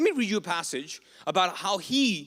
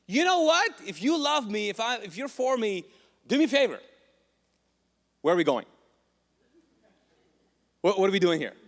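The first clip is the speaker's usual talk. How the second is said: loud, high, insistent demands; demanding prayer; 4 semitones higher, 5 dB louder than usual. Acted. Praying person demanding of God, loud and high. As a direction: press God for answers.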